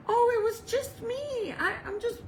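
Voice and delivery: high-pitched, mildly sheepish excuse; acted-out reassurance, pitched high; sheepish explaining. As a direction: explain it away in a small high voice. Sheepishly explaining away her scream.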